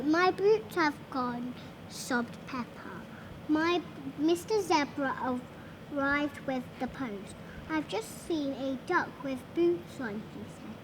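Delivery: usual speaking voice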